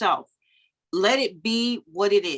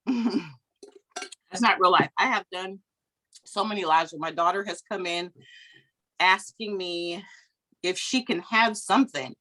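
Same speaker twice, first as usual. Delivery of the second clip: usual speaking voice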